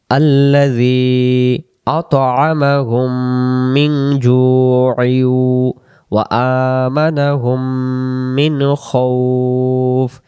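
slow, drawn-out chanted recitation of each syllable; slow Quran recitation. Shown as a demonstration. Teacher reciting slowly for students to repeat.

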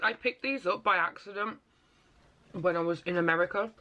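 talking between bites; chewing candy. Real, not acted. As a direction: mouth partly full. Seen 3: Eats candy pieces from hand, chewing while talking throughout.

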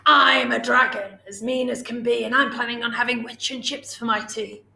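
big menacing dragon voice, loud at the start; storybook dragon threatening dinner; scary dragon. A narrator's reading. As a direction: boom it out like a mean dragon. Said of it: "a voice that was scary and loud".

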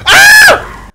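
very high, loud shriek; real piercing scream of shock; startled scream. Real, not acted. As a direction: scream in sudden fright. Startled by film scene.

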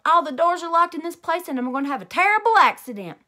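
loud, high, rushed panic; panicking on the phone to nine one one; yelling. A narrator's reading. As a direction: blurt it out fast. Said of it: "I yelled".

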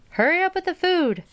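high-pitched call to the next room; mild, high storybook holler. Acted. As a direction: holler it high, without real volume. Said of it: "she hollered to Zach".

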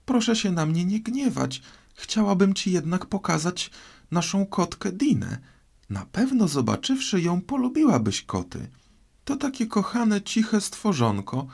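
put-on high, sweetly pleading girl; fond, high-pitched girlish chatter; coaxing Alice. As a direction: coax an offended listener in a high voice.

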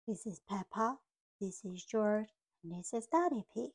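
plain reading voice

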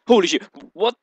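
sudden loud startled outburst. Real, not acted. Gamer startled by the on-screen character, loud surprise. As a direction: jump out of your skin at the screen. Startled reaction while playing.

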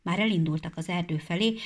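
plain reading voice

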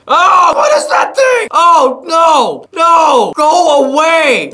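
very high, loud cries of alarm; panicked screaming. Real, not acted. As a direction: scream at the monster to back off. Monster appears in game.

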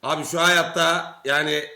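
ordinary talk

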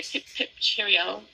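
mock posh London accent; hammed-up British accent. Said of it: "How's my London accent?".

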